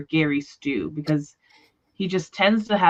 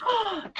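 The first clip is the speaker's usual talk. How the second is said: mock gasp; 5 dB quieter than usual. Mock shock at enemy territory.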